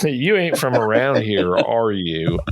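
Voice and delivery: lightly put-on thick Southern drawl; mild mock Southern backwoods voice; Southern accent. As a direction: play a suspicious Southern local. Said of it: "crazy, like, Southern accent".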